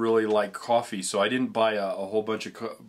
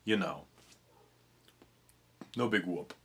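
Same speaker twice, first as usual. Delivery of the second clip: Cup of coffee with a hint of New England accent; light New England accent on Cup of coffee; 3 dB quieter than usual. Shown as a demonstration. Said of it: "now I'm starting to get that accent there".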